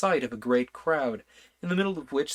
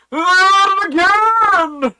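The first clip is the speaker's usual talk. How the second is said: very high, loud, slow bellow; shout at the top of his voice; 16 dB louder, much slower than usual. Acted. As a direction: blast it right into the king's ear. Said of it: "shouted at the top of his voice".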